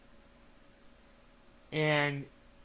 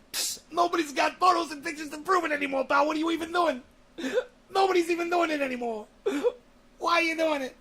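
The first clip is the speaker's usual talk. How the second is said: mock rant; 11 semitones higher, faster than usual. Mocking an angry commenter in a high shout. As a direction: yell it like an outraged grump.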